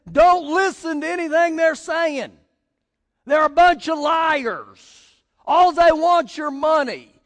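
loud, high heckler's yell; retold yelling. Man on balcony heckles street preachers, yelling. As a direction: holler it down at them, imitating an angry heckler. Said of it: "he yells down at us".

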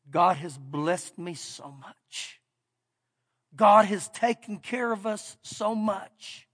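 hushed gratitude, almost breaking; tearful, softly. Retold or imitated. Young husband repeating his thanks to the pastor, softly tearful. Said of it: "He has these tears coming down".

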